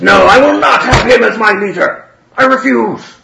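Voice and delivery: high, loud impassioned refusal; defiant shout. Acted. Father Russian refusing Wolfgang as leader, defiant high shout. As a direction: cry defiance to the whole hall.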